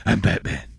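lightly gruff superhero impression; classic Batman. Host demonstrates the classic movie line, lightly put-on superhero voice. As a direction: do the familiar masked-vigilante catchphrase voice. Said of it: "that's the classic Batman".

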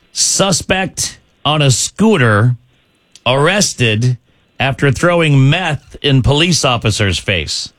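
deliberate announcer read with pauses; slow quiz-host delivery, pausing for effect; slow headline read. Real, not acted.

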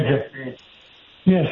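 ordinary talk, nothing marked

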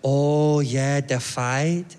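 imitated Costa Rican accent; easygoing local with a Costa Rican accent; lightly accented Costa Rican guy. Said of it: "And the local guy".

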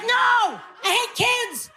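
high-pitched exasperated yell; acted high outburst of a parent at breaking point; frantic shouting. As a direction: shout back at a chanting mob of kids. Mom snaps back at chanting kids.

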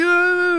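put-on very high kid's wail; child's cry. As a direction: wail like a lost little kid. Lost kid crying out.

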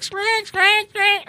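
high squeaky chirps; monkey bird squeaks. Retold or imitated. Host mimicking the birds outside the house, high squeaks. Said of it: "It's like".

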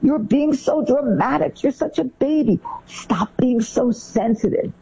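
put-on high, slow, scornful scolding of a whiny kid; dismissive parent. Parent at the playground dismissing a crying kid, scornful. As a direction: brush off the tears with mock impatience.

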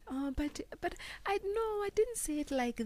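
high, breathy, faltering protest; threatened, weak voice. Shown as a demonstration. Woman shows how her voice weakens when threatened, timid high plea. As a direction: shrink into a soft, high plea, faking being threatened. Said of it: "when I'm feeling threatened, it's not going to come out as strong".

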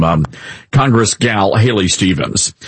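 usual speaking voice

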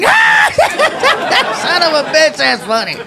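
hammed-up cop cracking up; dying laughing. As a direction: burst into high laughter, then gasp out the words. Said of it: "The cop starts dying".

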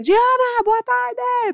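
very high, drawn-out greeting call; grito animado imitado. Retold or imitated. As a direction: imitate a guard calling out cheerfully. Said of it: "ele grita pra ela também". (chamado agudo e animado, imitando)

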